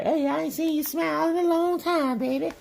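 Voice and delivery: put-on high sweet old lady voice; doting grandma cooing. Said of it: "my grandma be like".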